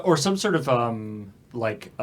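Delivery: ordinary talk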